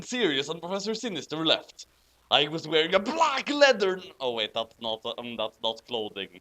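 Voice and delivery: ordinary talk, nothing marked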